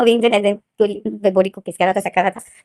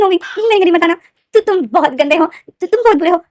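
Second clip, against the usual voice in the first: higher, hurt and scolding; high sulky scolding; 6 semitones higher than usual. Acted. To Wing after the night: high sulky scolding. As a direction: scold him like a sulking child.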